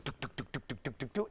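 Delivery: soft, quiet clicking imitation of pulses; imitating the shockwave device at work, softly clicking; device tapping noise. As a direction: tap out the machine's rhythm under the breath.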